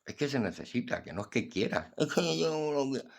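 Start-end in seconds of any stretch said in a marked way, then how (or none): none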